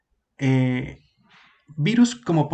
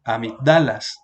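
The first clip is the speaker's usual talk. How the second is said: "amigdalas" stressed on the third syllable, a-mig-DÁ-las; wrong stress on DA; 3 semitones lower, 3 dB louder than usual. Shown as a demonstration. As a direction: move the stress of "amigdalas" onto DA. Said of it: "si lo ponemos aquí sería". («amigdalas» acentuado en DA: amigDÁlas)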